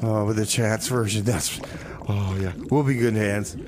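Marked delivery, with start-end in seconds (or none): none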